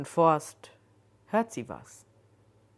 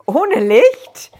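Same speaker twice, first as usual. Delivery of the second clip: startled, much higher and louder; much too high; 9 semitones higher, 14 dB louder than usual. Acted. Joan, frightened in the dark forest, asks in a shrill high voice. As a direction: squeak it out, still shaken by the fright. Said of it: "in immer noch viel zu hohem Ton".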